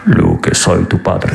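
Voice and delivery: slow, low, mock Vader in Spanish; Spanish Darth Vader. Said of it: "Dark Vador s'exprime dans la langue du reggaeton". (Dark Vador doublé en espagnol, grave et lent)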